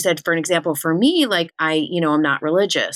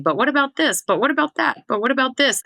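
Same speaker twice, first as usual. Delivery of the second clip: skeptical listener's objections; 10 semitones higher than usual. Retold or imitated.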